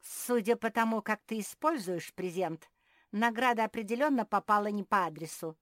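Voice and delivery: loud, caustic jibe; sarcastic. A narrator's reading. Marie mocks Phil's use of the present, sarcastic. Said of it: "съязвила Мари".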